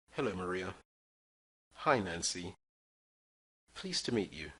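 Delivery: usual speaking voice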